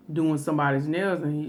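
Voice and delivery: ordinary delivery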